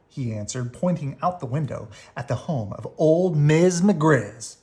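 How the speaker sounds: ordinary delivery, nothing marked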